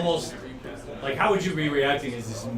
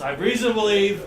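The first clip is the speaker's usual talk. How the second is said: higher, louder call to allies; yelling out; 5 semitones higher, 5 dB louder, faster than usual. Acted. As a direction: call it out with raised voice. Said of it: "he, he yells out".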